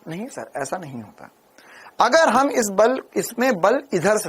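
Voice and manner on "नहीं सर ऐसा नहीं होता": a lightly voiced student, much softer and lower; student's reply. Teacher voices a student correcting him, softly. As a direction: answer the teacher meekly as a pupil.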